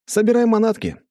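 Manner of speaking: high, loud cheery order; calling out. Acted. Hero summoning Alexander to a picnic, calling out high. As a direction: call the order across a distance. Calling to friend across the yard.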